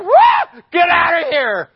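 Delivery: high explosive angry shout; imitated furious yell; mad commercial guy. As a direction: roar it like an enraged man in a TV ad. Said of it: "gets mad, and he just goes".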